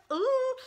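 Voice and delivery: high, loud "ooh"; high excited ooh; delighted squeal. Real, not acted. Gushing over the rainbow highlighter.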